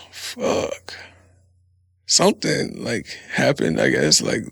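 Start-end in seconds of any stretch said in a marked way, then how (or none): 0.1-0.9 soft voiceless fuck; quiet whispered swear; whispered curse. Shown as a demonstration. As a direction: breathe it out softly. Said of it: "it's a lot different if somebody says".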